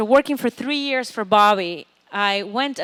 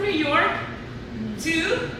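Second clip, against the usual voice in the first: slow, higher, then laughing out; 7 semitones higher, much slower than usual. Real, not acted. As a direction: drag out the punchline and laugh. Punchline about moving.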